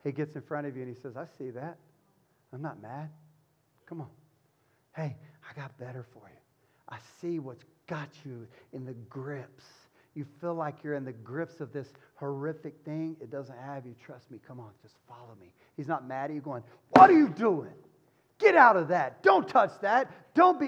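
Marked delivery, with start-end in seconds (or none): 1.2-15.5 softer and tender, coaxing you to follow; gentle shepherd voice. Retold or imitated. Jesus gently calling a struggling person to follow. 16.9-20.7 higher and louder, a sharp scold; mock scolding. Preacher mimicking an angry religious scolder.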